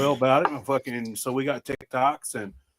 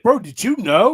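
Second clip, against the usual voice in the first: eager, higher and louder; excited; 8 semitones higher, 6 dB louder than usual. Retold or imitated. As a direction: blurt it out like a discovery. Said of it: "I was like".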